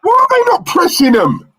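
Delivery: very high, outraged yell; high-pitched fan's outcry; real exasperated shout. Real, not acted. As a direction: yell at your team in frustration. Exasperated at the team.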